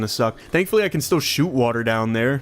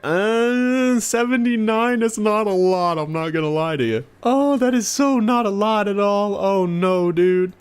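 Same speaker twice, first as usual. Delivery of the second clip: high, whiny letdown; cartoonish high whine at a small sum; whiny high mock voice; 7 semitones higher than usual. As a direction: squeak out your disappointment. Disappointed squeaky mock voice.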